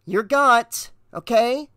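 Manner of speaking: high-pitched, snippy reply; nasty retort. A narrator's reading. Dispatcher answering the officer's order, nasty. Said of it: "she replied nastily".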